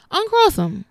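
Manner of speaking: high, punchy sassy order; sassy command. Real, not acted. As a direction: snap it like a best friend telling you off. Sassy instruction to cross-legged viewers.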